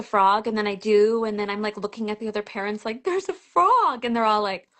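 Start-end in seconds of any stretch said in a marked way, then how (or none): 3.0-4.0 high, drawn-out retold exclamation; acted-out excited call; excited announcement. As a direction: announce it to everyone, stretched out and pitched up. Retold announcing frog to parents.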